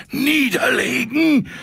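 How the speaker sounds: very high, slow, quivering echo of niederlegen; trembling with excitement. A narrator's reading. Drunk conductor trembling at the word, quivering high squeak. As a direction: squeak it out, shaking all over. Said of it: "zuckte und schlotterte am ganzen Leib".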